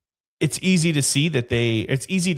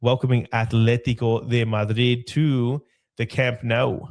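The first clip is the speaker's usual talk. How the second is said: usual speaking voice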